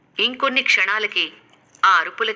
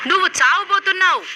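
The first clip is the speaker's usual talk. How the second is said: high, loud menacing demon threat; terrible demon roar; 12 semitones higher, 4 dB louder than usual. Acted. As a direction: snarl it like a hidden monster. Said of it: "భీకరమైన శబ్దం". (భయంకరమైన రాక్షసుడి అరుపు)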